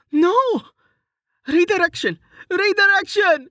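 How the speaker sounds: high, frantic cries; desperate panic. A narrator's reading. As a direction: shout the spell in terror. Falling, casting spell desperately.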